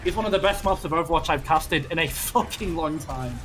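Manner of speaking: high, loud hype casting call; live play-by-play. Acted. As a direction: call the comeback at full hype. Hyped casting of the final fight.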